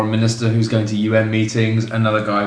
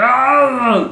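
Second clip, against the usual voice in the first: Wookiee roar; 13 semitones higher than usual. Pretend. Wookiee battle cry one-liner.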